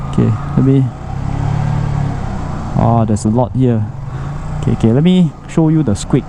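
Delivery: ordinary delivery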